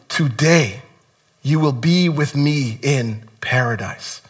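soft, slow promise; quietly tender, unhurried words. Retold or imitated. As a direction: gently reassure the dying man. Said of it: "And he said to him".

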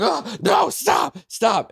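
high, loud frantic shouting; Whitney screaming to stop the dog fight; panicked shout. Retold or imitated. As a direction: yell at the fighting dogs.

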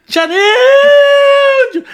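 long, very high, loud holler; hammed-up scream stretched out on the name; retold exaggerated scream. Injured nephew screaming down the phone to aunt, hammed-up wail. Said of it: "eu gritei assim".

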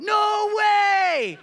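high, loud yelp of disbelief; shocked outburst. Retold or imitated. Pastor recalling meeting Cyril Regis, loud stunned shout. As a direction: blurt it out, stunned and starstruck.

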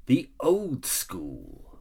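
very slow, drawn out topic; dramatic announcement. Acted. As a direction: stretch the old school out slowly. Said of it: "today we're doing a podcast on".

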